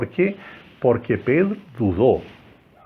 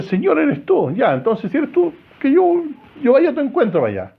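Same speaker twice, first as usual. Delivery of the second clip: high, louder, cocky Pedro voice; brash Pedro; 12 semitones higher, 4 dB louder than usual. Acted. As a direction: play the bold fisherman calling out. Pedro voiced eagerly in retold story.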